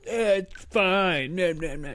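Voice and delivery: high, slow, airy wave-off, imitating the friend; mock the kid waving off the danger; dismissive brush-off. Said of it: "And he's like".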